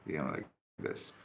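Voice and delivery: low whispered "you know, like this"; hushed intense look. Shown as a demonstration. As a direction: breathe it while holding eye contact. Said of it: "just shut up and just look into her eyes".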